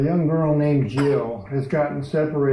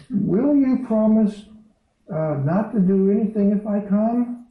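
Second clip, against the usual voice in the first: put-on frantic thirsty girl; 8 semitones higher, 3 dB louder than usual. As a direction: beg the lion as a scared young girl, pitched well up. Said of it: "She's nearly frantic with thirst".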